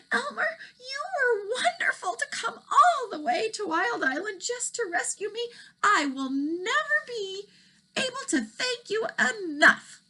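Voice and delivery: a gentle dragon gushing thanks; grateful dragon. Acted. Dragon voice in read story.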